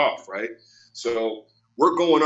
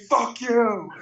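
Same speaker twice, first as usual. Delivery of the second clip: higher mock yell; imitated angry curse; 9 semitones higher than usual. Driver back out of car, mock angry yell. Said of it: "He goes".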